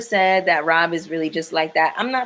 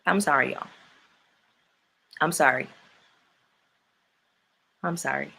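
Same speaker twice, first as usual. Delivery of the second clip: soft, low apology after an outburst; hushed, low sorry; subdued apology; 6 semitones lower, 8 dB quieter, faster than usual. Real, not acted. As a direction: apologise quietly, winding down from a rant. Apologising after a sweary rant.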